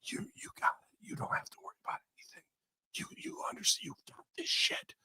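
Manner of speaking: hushed, unvoiced mock reassurance; whispering. Host mimics boss comforting crying wrestler backstage, whispering. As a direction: whisper it softly as if into someone's ear. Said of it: "whispers in her ear".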